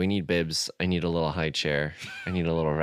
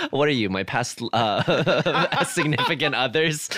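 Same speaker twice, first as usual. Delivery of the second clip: laughing; 6 semitones higher, 3 dB louder, slower than usual. Real, not acted. Teasing co-host, laughing through words.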